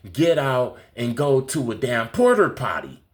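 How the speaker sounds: usual speaking voice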